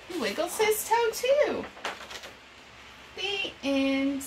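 put-on bright, lively read-aloud for young kids; storytime reading. Reading an animal picture book to children, bright storytime voice.

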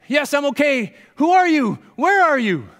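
high, loud shouted questions; calling out. Acted. Jack asks who the unseen voice is, calling out.

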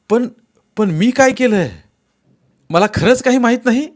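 very high, loud frantic denial; desperate plea. Acted. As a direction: beg them to believe you.

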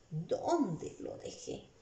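drawn-out, quiet self-questioning; asking oneself. Acted. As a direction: wonder it to yourself, stretching the words. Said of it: "Se está preguntando ella misma".

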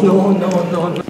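usual speaking voice

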